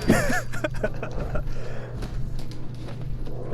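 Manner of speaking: high, giddy laugh; a gleeful high laugh; high giggle. Real, not acted. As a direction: laugh wildly through the fight. After charging in.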